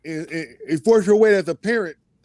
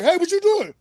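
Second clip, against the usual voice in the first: much higher, sharp mock call; startled dad; 11 semitones higher than usual. Father retells bursting in on his cussing kids, surprised call. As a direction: catch the kids in the act, pitched up. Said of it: "And I'm like".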